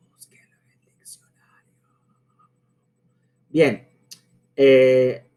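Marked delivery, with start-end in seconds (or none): 0.1-1.7 a sly breathy whisper, no voice at all; mock whisper. Teacher teasing students who won't look it up, whispered. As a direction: hiss it like a secret aside.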